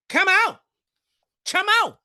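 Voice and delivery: high, loud call; shout. Real, not acted. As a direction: yell it at the chart to come out. Yells at the price chart.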